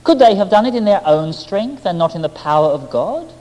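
half persuading, half mocking. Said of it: "she smiled at me, half persuading, half mocking".

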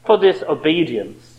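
normal talking voice

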